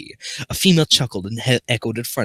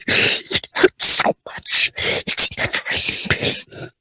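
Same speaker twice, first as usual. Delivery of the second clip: slow whimpering fake sob; mock crying; 3 dB louder, much slower than usual. Real, not acted. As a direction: whine it out in mock pain. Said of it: "All I feel is never-ending agony".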